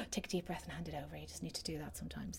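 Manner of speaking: dropping to a soft whisper; hushed advice. Shown as a demonstration. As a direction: breathe it out almost in a whisper. Advising to let go of control.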